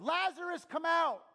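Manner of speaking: high, very loud calling shout; big booming summons, pitched high. Acted. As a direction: shout him out of the tomb. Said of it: "Then he shouted".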